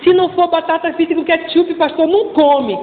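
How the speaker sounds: lightly voiced fussy parent; parent explaining. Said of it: "parent to pastor".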